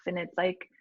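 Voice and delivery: ordinary delivery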